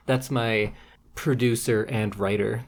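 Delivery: normal talking voice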